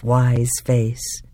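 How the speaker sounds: usual speaking voice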